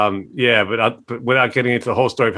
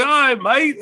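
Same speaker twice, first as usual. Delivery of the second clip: mate; 13 semitones higher, slower than usual. Retold or imitated. [name] and [name] agreeing.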